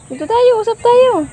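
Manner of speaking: high quick coaxing. Real, not acted. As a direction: chirp it fast and high. High fast coaxing to baby.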